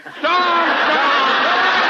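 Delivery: drawn-out Southern shout; bellowed catchphrase. Acted. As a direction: hammer the punchline home like a windbag. Catchphrase punchline repeated.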